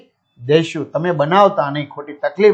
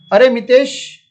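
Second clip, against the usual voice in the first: Mr Parikh calling out; 6 semitones higher, 3 dB louder than usual. Acted. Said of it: "mister Parikh".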